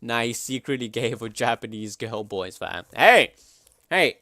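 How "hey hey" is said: a sudden loud, high "Hey!"; loud shout. Real, not acted. Sudden call-out between friends.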